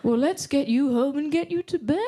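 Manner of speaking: mock-parental coddling; soothing parent voice. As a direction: tuck a grown adult in like a child. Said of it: "they're gonna go".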